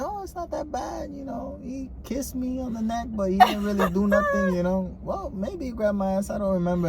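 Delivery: high, soft, hesitant excuse; small high voice, mock denial; acted self-convincing. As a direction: play someone softly talking themselves out of it. Voices someone convincing themselves afterwards.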